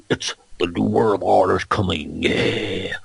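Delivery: low growly parody voice with a gasp first; Alex Jones. Retold or imitated. Mimicking followers of the conspiracy host, low parody voice. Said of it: "putting down Alex Jones in that voice".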